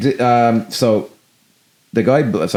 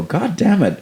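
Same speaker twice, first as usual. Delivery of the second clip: high exasperated voice; 10 semitones higher, slower than usual. Retold or imitated. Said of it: "you just kinda go".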